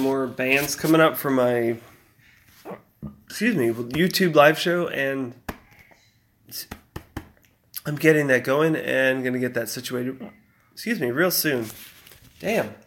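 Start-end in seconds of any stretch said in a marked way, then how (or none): 3.3-12.7 hiccups breaking the sentence. Real, not acted. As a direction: keep apologising through hiccups. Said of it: "I got the hiccups so bad".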